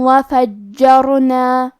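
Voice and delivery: وفجرنا recited very slowly, with the jeem doubled; tajweed demo. Shown as a demonstration. Teacher shows students the doubled jeem, slow recitation. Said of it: "جیم کو پڑھنا ہے".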